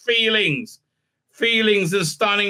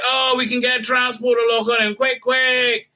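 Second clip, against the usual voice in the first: mock urgent crew; 6 semitones higher than usual. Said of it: "and they're like".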